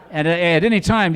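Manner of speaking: ordinary talk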